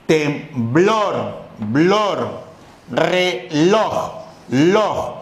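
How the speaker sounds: "temblor" and "reloj" split, then BLOR and LOJ repeated alone; stressed syllable demo. Shown as a demonstration. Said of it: "Que la sílaba tónica". ("temblor" y "reloj" separadas, repitiendo alargadas BLOR y LOJ)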